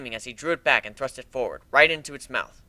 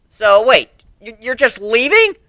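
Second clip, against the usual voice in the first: high, loud, halting plea; dismayed young colt; 10 semitones higher, 10 dB louder, slower than usual. Acted. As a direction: stammer it out, hurt and shocked. Whiplash dismayed.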